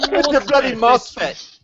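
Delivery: put-on high, slow London accent; hammed-up London accent from a high voice; mock London accent. Friends joking about a MOSFET, mock London accent. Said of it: "You sound like you're from London".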